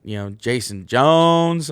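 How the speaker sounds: slow loud name announcement; drawn-out name. Real, not acted. Introducing his buddy to viewers, drawn-out announcement. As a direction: stretch the name out.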